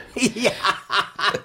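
knowing chuckle. Acted. Homais laughs at Paris dangers.

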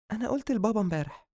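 put-on high, quick girl's voice; light daughter at breakfast. A narrator's reading. Daughter telling brother at breakfast, girlish.

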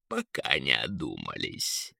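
deep, soft sigh of a word; sighing eagle. A narrator's reading. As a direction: let it drop low like a sigh. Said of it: "вздохнул Эрвин". (низко, со вздохом)

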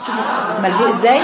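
ordinary delivery

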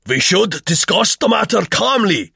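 anxious. A narrator's reading. Vogel interrupts the quarrel, anxiously. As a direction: butt in anxiously, voice lifted. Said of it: "put in Vogel anxiously".

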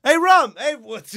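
loud, high put-on voice; big pussy voice. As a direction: call out loudly in a silly character voice. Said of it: "You gotta do it in the big pussy voice".

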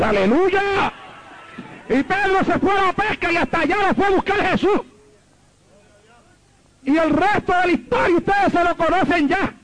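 high-pitched rousing pulpit cry; exultant call to the congregation; fervent preaching. Real, not acted. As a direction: preach it out high, holding the final vowels.

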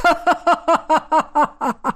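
long, high maniacal cackle; wild laugh. A narrator's reading. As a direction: cackle madly in a rapid string. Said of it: "Дикий смех раздался снаружи". (дикий хохот)

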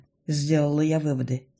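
ordinary delivery

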